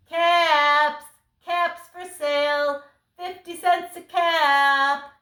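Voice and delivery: loud, high, drawn-out vendor's cry; street seller calling. Acted. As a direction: call your wares out across town, half sung. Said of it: "he walked back to town calling".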